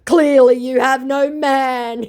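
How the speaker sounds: high, slow, loud sneering retort; lightly hammed-up scammer sneer; mock scammer voice. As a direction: play the smug scammer, drawn out. Voicing scammer's retort in chat.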